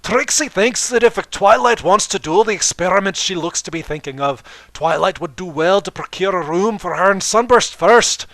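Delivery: pompous teasing, almost laughing; laughing Trixie. Acted. Trixie teasing Twilight on the way out, loud and pompous. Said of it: "Trixie laughed".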